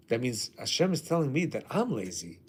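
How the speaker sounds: normal talking voice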